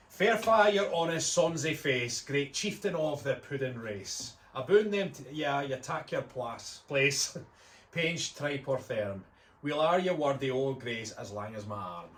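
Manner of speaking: slow Scots verse recital; broad Scottish accent. Acted. The Dress to the Haggis.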